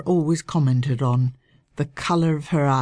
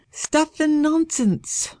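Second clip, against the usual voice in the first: high, slow and firm dismissal; decided; 12 semitones higher, slower than usual. Acted. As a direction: brush it off decisively, high and slow. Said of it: "Lady Audrey said decidedly".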